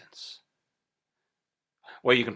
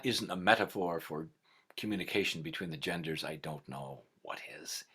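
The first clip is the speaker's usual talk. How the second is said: whispered aside; 6 semitones lower, 7 dB quieter than usual. A narrator's reading. Wry aside to the audience.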